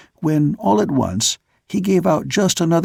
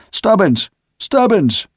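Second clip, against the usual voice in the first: shout; 7 semitones higher, slower than usual. A narrator's reading. Sailor calling up to a mate in the dark, shouting. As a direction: call his name up into the dark. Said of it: "I shouted".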